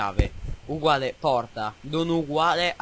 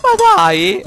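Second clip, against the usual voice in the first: high shocked yell; surprised shout; 13 dB louder than usual. Real, not acted. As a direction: shout it in disbelief. Surprise in horror game.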